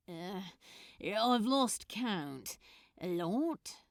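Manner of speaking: slow, lightly voiced worn-out man; hint of a tired grump; weary Crowley. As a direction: drag the words out, exhausted. Exhausted Crowley answers elf.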